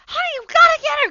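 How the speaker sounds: very high, loud, rushed plea; mock panicked shriek. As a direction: shriek for help as frantic mom. Acts mother's desperate call for help.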